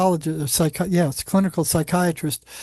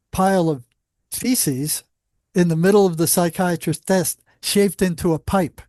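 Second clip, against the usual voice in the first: ordinary talk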